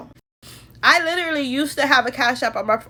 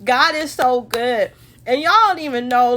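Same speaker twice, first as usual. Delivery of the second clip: normal talking voice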